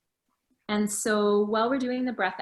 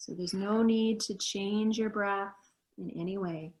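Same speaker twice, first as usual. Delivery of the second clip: guided meditation. Acted. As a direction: guide them gently into stillness. Leads a meditation.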